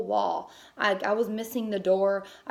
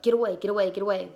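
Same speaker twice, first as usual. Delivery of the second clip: firm shooing a dog away; mild yell to chase off a dog; retold shooing; 4 dB louder, much faster than usual. Woman kicking the dog away from the babies, retold shooing.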